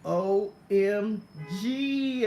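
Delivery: very drawn-out, emphatic O M G; exclaimed as written. Acted. Reading own notes to viewers, drawn-out exclamation. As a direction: stretch each letter, dragging out the G. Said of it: "I literally write the same way that I talk".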